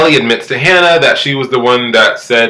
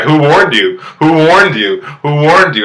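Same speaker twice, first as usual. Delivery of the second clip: raised-pitch mock demand, repeated; higher, insistent imitation; retold insistent demand; 5 semitones higher than usual. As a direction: press the question like an indignant quote.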